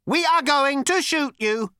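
high, slow, lifeless robot rasp; cold robot voice. Acted. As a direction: threaten flatly like a machine. Robot threatens Zaphod.

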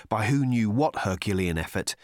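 plain reading voice

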